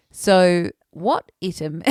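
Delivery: slow, loud reading, "item" said "ITT-um"; "item" mispronounced with a short first vowel; misread item. Retold or imitated. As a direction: read out "item" deliberately as "ITT-um". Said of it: "went back to her reading".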